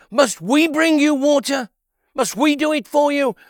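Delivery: loud, high, furious questioning; angry rebuke. Acted. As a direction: scold the people in a rage. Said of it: "He was angry".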